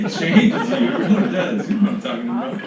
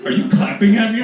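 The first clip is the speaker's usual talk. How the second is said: offended partner; 5 semitones higher, 6 dB louder than usual. Retold or imitated. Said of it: "they're gonna be like".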